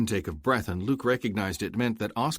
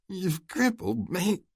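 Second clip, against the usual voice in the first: higher, slow pained groan; 12 semitones higher, slower than usual. Acted. Oscar groans at Luke from the ground, in pain. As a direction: force it out through pain. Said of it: "Oscar groaned".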